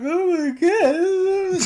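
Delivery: drawn-out high, loud mock wail; mock crying wail. Expectant father retelling his tearful moment, mock crying wail. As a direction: wail it like an exaggerated sob. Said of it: "just crying".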